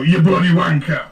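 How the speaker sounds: loud, slurred British insult, bloody stressed; muddied British accent. Shown as a demonstration. Showing a friend how to grunge up the accent, loud and slurred. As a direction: mumble it rough like a bloke down the pub. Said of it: "You just go".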